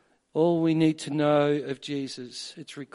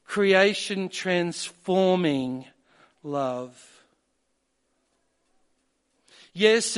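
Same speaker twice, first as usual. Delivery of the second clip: louder, higher preaching build; rising preaching; 4 semitones higher, 5 dB louder than usual. Acted. Sermon building to a climax.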